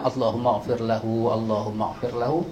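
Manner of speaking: low, soft, repeated short doa; softly repeated prayer. Shown as a demonstration. Preacher showing the short form of the doa, low and soft. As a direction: murmur the short prayer twice. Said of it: "hak pendek ulang".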